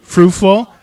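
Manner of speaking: "Fruitful" called out loud and high, full of life; a lively shout of "Fruitful"; said like you're alive. Shown as a demonstration. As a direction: make "Fruitful" ring out like you mean it. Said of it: "say it like you're alive".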